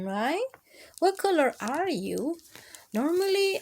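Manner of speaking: plain reading voice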